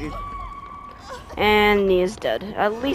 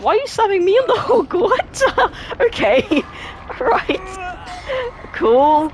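incredulous laughing; 11 semitones higher than usual. Real, not acted. Player slapped on the hook, laughing in high disbelief. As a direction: squeal the words through helpless laughter.

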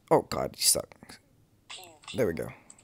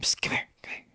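whispering; 5 dB quieter, faster than usual. Real, not acted. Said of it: "Why are you whispering?".

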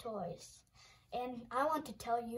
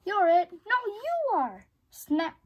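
louder, higher teasing; playful tag; 5 semitones higher, 8 dB louder, slower than usual. Acted. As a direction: chase and tease your friend. Friends playing tag.

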